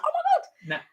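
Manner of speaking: high squeal of disbelief; amazed. Retold or imitated. Recalling the big win, high amazed squeal. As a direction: gasp it out, stunned. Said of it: "I was just like".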